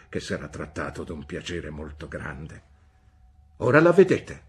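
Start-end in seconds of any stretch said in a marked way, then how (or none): 3.6-4.4 loud, high and emphatic; the aunt asserts herself before the mother; firm declaration. A narrator's reading. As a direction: plant the words like a challenge. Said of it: "affermò Miss Betsy".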